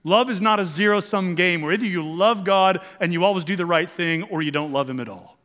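ordinary talk

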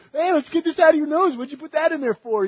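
high, playful doctor voice; cheerful doctor. Retold or imitated. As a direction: amuse the kid like a jolly nurse.

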